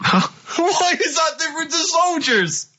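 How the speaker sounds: slow, very high-pitched wail; exasperated disbelief. Real, not acted. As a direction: wail the question as if near tears. Baffled by Carl's absurd answer.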